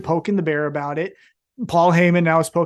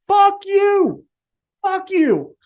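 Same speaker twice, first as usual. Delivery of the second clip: fuck you flung out high and loud, slow; angry; 11 semitones higher, 4 dB louder, much slower than usual. Real, not acted. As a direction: spit out the fuck you in real frustration. Angry outburst at Cody storyline.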